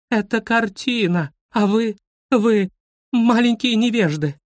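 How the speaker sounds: high-pitched, indignant scolding; outraged cry. A narrator's reading. Guide scolds ignorant schoolchildren, outraged cry. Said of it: "завопила экскурсовод".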